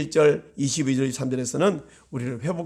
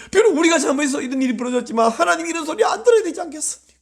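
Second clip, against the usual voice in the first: fast, high, shouted plea; pleading shout; 9 semitones higher, 3 dB louder, faster than usual. Real, not acted. Pastor crying out to God on behalf of the church. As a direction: cry out to God, rushing.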